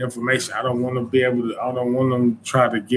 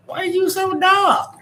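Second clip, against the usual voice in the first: much higher, squeaky imitation of an elderly woman; old lady voice; 4 dB louder than usual. Old woman in the grocery aisle asking him, squeaky high mock voice. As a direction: pitch way up to play the old shopper.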